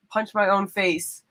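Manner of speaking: usual speaking voice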